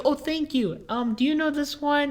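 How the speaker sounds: high imitated old-lady voice. Old lady thanking her helper, high imitated voice. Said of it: "She goes".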